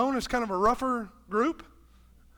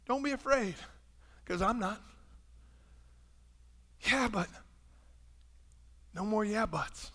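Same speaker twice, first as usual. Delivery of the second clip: tender reassurance; 6 dB quieter than usual. Pretend. Jesus softly reassuring Peter.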